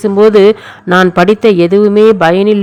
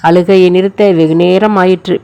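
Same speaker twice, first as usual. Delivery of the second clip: plain reading voice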